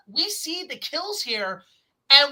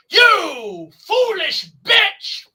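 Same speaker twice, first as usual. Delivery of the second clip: very slow, loud, higher monster snarl; Indominus voice; 8 semitones higher, 8 dB louder, much slower than usual. Retold or imitated. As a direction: draw every word out like a furious beast. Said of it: "He's like".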